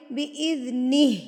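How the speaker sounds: بِإِذْنِهِ ending on a vowelless breathed "h"; stopping pronunciation. Shown as a demonstration. Showing students how to pause, بِإِذْنِهِ ending in still "h". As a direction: close بِإِذْنِهِ on "nih". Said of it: "تو آپ اس کو پڑھیں گے".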